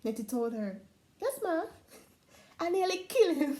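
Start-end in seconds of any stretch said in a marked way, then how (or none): 1.2-3.6 laughing fib. Acted. Girl fibs to lady, laughing.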